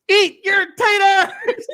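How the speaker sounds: high, loud, drawn-out mock yell; catchphrase holler. Host playing the sound clip himself, high drawn-out yell. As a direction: holler it slowly, breaking into laughter.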